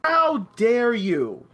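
slow, drawn-out mock outrage. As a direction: stretch it out in fake indignation.

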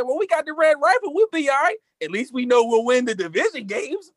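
high-pitched mock fan voice; mimicked Cowboys fans. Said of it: "A few Cowboy fans told me".

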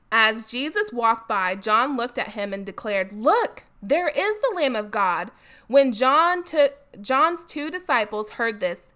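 animated, projected Bible reading; scripture reading aloud. A narrator's reading. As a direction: read the story out with emphasis. Reads Bible passage aloud.

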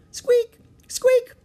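very high, loud mouse squeak. A narrator's reading. Peanut greeting Jack and Annie, mouse squeak. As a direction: squeak like a tiny excited mouse.

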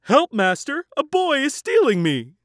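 high, loud alarm call; magic harp calling the giant; harp crying for help. A narrator's reading. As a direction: shriek for your master. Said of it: "the magic harp cried".

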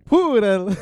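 high, loud silly accent on Poodle; different fun accent. Shown as a demonstration. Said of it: "a different fun accent".

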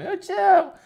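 daughter voice. Retold or imitated.

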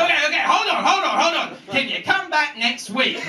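high-pitched, hammed-up pleading; panicked stalling. Victim pleads for delay.

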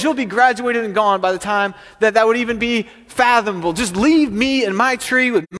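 high, heated protest; worked-up, fed-up rant; exasperated farmer. Acted. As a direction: argue it down and send the kid away. Exasperated farmer dismisses the boy.

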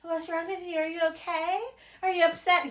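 soft, mock-caring questions, lightly voiced; imitating the friend who asks if she's upset, soft and faux-sweet; mock concern. Imitating a worried friend quietly, mock concern. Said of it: "they're like".

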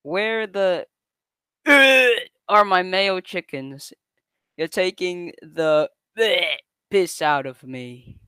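almost shouting. Acted. Irate customer demanding mayo chickens, almost shouting. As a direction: read it as a half-shouted rant. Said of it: "almost shouting now".

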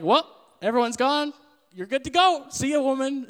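high, loud, casual send-off as Jesus; breezy Jesus. Retold or imitated. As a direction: toss it off lightly like waving her away.

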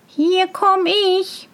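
high, drawn-out singsong call. A narrator's reading. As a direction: announce yourself from down the road. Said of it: "schrie Tölpelhans".

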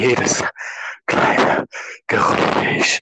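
very close to the mic, every breath heard; mouth right on the mic. Shown as a demonstration. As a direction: lips almost touching the mic. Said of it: "Ob's gut ist, wenn ich so mache".

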